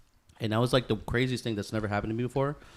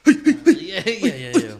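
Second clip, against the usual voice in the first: laughing; 9 semitones higher, 12 dB louder, much slower than usual. Real, not acted. Laughing while agreeing.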